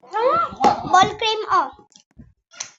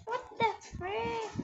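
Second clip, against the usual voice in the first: normal talking voice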